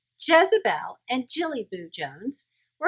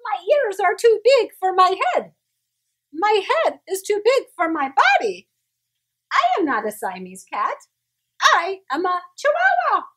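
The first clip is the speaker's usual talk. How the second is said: lightly voiced Spanish accent, higher and louder; 9 semitones higher, 7 dB louder than usual. A narrator's reading. Kitten to the mirror, light Spanish accent. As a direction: announce it proudly with a hint of Spanish accent. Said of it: "using his very best Spanish accent".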